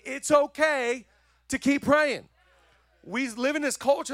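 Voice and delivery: high, loud preacher's build on keep praying; urging the congregation to keep praying, loudly; full-force preaching. Acted. Pastor exhorting the church, high and loud preaching.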